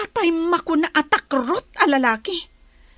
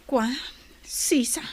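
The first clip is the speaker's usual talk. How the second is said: whisper; 5 dB quieter than usual. Acted. Quiet confiding to friend.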